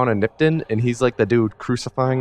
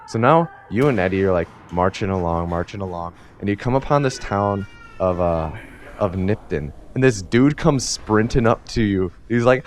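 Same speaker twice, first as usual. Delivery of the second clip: normal talking voice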